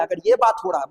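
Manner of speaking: ordinary delivery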